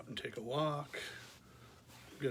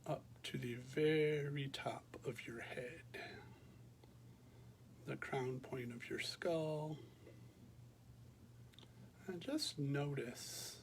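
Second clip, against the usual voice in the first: calm, unhurried meditation voice with long pauses; guided meditation; 8 dB quieter than usual. Real, not acted. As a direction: guide listeners gently through a body scan, leaving space after each phrase. Leads a body scan meditation.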